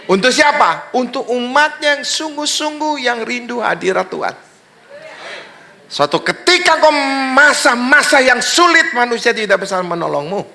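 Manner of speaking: high, impassioned sermon delivery; full-force preaching. Real, not acted. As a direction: drive it home to the congregation, pitched high.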